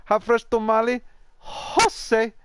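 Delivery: imitating a street tamale seller with a Mexican accent; tamale lady voice. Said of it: "and she goes".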